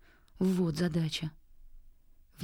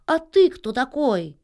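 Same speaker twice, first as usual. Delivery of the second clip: higher, louder, slowed indignant challenge; angry drake shout; 4 semitones higher, 5 dB louder, slower than usual. A narrator's reading. Drake yells at the unseen heckler, angry shout. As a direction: shout it crossly at whoever interrupted. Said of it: "сердито закричал селезень".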